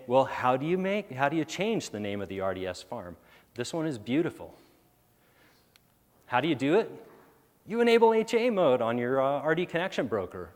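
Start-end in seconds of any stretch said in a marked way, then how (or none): 3.5-10.5 dripping sarcasm, pitched way up; mocking, exaggerated disbelief; sarcastic. Real, not acted. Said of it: "my sarcasm is coming out".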